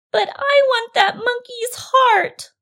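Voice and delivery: very high, loud, drawn-out whine; whining crocodile daughter. Acted. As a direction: wail it like a demanding little child. Crying daughter whines to father.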